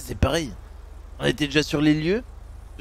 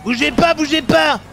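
shouting; 12 semitones higher, 7 dB louder than usual. Acted. Police officer shouting at suspect.

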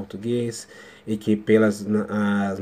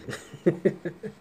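embarrassed laugh; 9 semitones higher, 3 dB quieter than usual. Real, not acted. Laughs at own mispronunciation.

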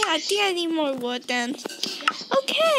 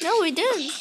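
ordinary talk

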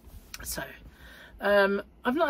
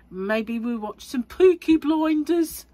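drawn-out, high, loud mock Peaky Blinders accent; terrible accent; 7 semitones higher, 8 dB louder, slower than usual. Vlogger shares evening plans, in a terrible overdone Peaky Blinders accent. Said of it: "that's a terrible accent".

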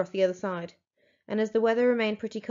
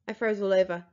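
ordinary delivery

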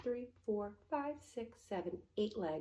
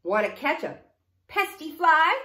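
rooster's invitation; 6 semitones higher, 20 dB louder than usual. A narrator's reading. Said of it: "crowed the rooster".

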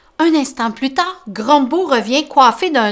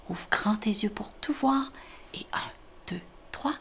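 hushed whisper counting to three; 12 dB quieter than usual. Acted. Storyteller to child, whispering.

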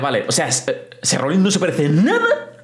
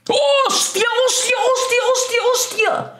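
excited high exclamations. Real, not acted. Big hit in the match.

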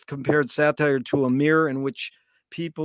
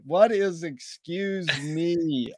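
slow, savouring echo of a punchline; drawn-out repeat; much slower than usual. Retold or imitated. Listener repeating the joke's punchline, slow and drawn out. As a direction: stretch every word of the punchline.

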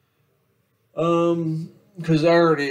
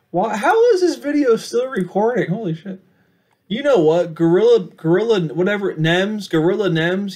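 loud, higher surprised rant; startled outburst; 9 semitones higher, 11 dB louder than usual. Real, not acted. Vlogger finds camera still recording, loud startled rant. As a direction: burst out that the camera still rolls.

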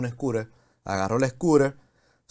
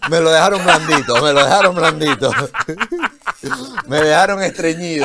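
loud, laughing through the words; laughing hard; 5 semitones higher, 8 dB louder than usual. Real, not acted. Guest laughing hard at host's joke, loud. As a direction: crack up while getting it out.

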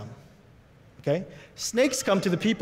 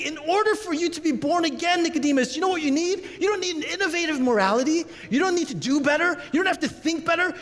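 preaching at full force; 11 semitones higher than usual. Acted. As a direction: preach it up high, pleading and urgent. Jesus voiced at full preaching force.

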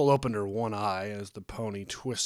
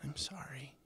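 high, very soft apology; small, hushed voice; ashamed mumble; 6 semitones higher, 8 dB quieter than usual. A narrator's reading. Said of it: "and mumbled".

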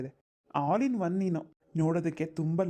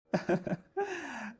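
a soft, bashful laugh; shy laugh; 6 semitones higher, 3 dB quieter than usual. Acted. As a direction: laugh it off gently. (ನಾಚಿಕೆಯ ಮೆಲು ನಗು)

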